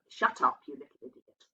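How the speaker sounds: barely voiced whisper, almost mouthed; silent mouthing. A narrator's reading. Narrator mouths the insult to the youngest girl. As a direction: mouth it silently over the shawl. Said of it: "said in blatant dumb show".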